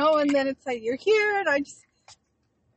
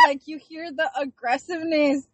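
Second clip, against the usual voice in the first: ordinary talk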